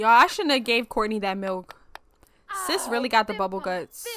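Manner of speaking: ordinary delivery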